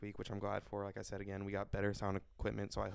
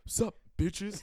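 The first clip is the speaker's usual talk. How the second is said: high, loud bro shout; loud casual greeting; 10 semitones higher, 7 dB louder than usual. Pretend. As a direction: blurt it out rowdy and casual. Mock anchors drop formal act.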